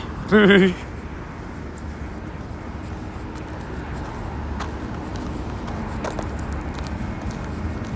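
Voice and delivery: long silent wheeze of laughter; helpless breathy laugh with no voice; long wheezing laugh at the game. Real, not acted. Laughs at Pokemon fleeing.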